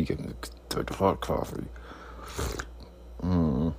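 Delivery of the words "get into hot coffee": breathy whisper. Acted. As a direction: whisper it to yourself. Whispered repeat.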